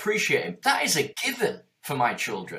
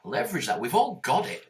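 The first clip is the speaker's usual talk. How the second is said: ordinary talk, nothing marked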